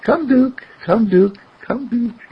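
high, gentle coaxing call; gentle loving call. Acted. Philip calling the dog in his heart, gentle and high. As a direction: call him tenderly. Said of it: "Just quietly, lovingly, gently".

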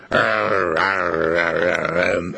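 short low barking growls; dog growls. Retold or imitated. Dog Love growls to intimidate Rex on the chain, low barks. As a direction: grr at him like a dog trying to intimidate. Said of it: "Love goes".

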